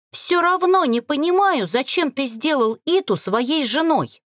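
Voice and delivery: sharp, high angry outburst; snapping angrily. Acted. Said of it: "рявкнула Лиам".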